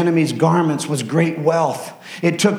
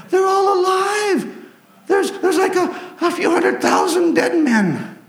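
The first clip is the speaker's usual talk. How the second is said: high, escalating amazement, laughing; astonished soldiers; 9 semitones higher, slower than usual. Acted. Judah's soldiers discovering the dead army, high astonished cries. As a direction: count the dead in disbelief.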